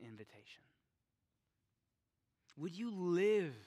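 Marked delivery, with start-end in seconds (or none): 2.6-3.6 loud fervent question; rising preaching call. Real, not acted. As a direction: lift the voice on live.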